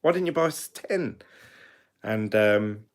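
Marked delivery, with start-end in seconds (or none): 0.0-1.2 higher, mock incredulous complaint; as if scolding them for not buying; exasperated. Asking old people why they didn't buy more, mock exasperation. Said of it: "And you're like".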